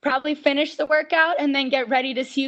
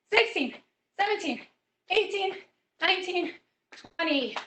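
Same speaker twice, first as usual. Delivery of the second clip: counting along breathlessly, a little softer; counting reps; 3 semitones higher, 5 dB quieter than usual. Real, not acted. Fitness instructor counting jumping jacks for the kids, breathless count.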